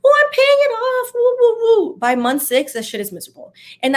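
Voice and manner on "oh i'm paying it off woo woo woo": high, loud, giddy cheer imitating her early joy; excited cheering. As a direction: squeal it like a fresh debt-payer celebrating. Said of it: "at first it's exciting".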